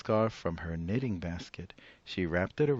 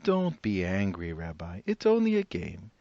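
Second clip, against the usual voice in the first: put-on old woman voice; 5 dB louder than usual. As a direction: play the cheerful old grandmother.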